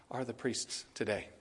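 said softly, almost to himself; a hushed, convicted admission; quiet. Real, not acted. Preacher hushing before the congregation, quiet and convicted. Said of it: "I even say it quiet".

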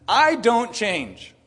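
slow, loud, high, amused; God answering. Acted. God answering the complaining people, slow and loud. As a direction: drag out each word with a laugh behind it. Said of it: "God turns around and says".

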